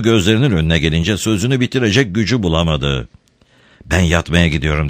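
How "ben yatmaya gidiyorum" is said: plain reading voice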